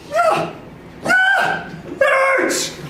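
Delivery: high, emphatic yes; shrieked yeah it hurts; excited yell. Real, not acted. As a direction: answer your own question with gusto. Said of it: "answering own question".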